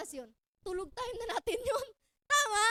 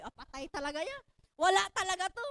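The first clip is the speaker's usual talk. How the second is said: ordinary talk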